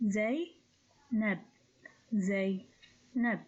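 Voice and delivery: "zay, nab" as two detached syllables with long pauses. Shown as a demonstration. Teacher splitting own name for learners, "zay, nab" syllable by syllable. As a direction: sound out "zay, nab" piece by piece. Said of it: "consists of only two syllables".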